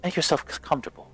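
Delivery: a hint of prim nobility; formal lady host. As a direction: play the gracious lady making small talk. Lady Fiona hosting her guests.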